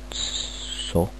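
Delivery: ordinary delivery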